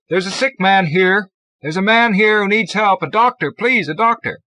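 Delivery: loud, high, urgent shout; shouting for help. A narrator's reading. Zell to the crowd over a sick man, shouting. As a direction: yell out for a doctor. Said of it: "Zell began shouting".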